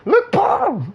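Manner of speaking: very high, squealed show-off voice; hammed-up high kiddie voice; excited little one. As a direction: call out proudly like an excited child. Same voice calling out again.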